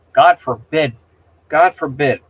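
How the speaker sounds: loud sarcastic God forbid; indignant outburst. Real, not acted. Streamer ranting to chat about Mary Poppins, indignant and loud. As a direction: throw the words out in disgust.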